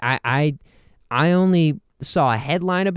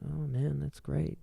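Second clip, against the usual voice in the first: soft, much quieter hollow agreement; mock fake agreement; 9 dB quieter than usual. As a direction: murmur a fake yes. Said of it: "that thing where people are like".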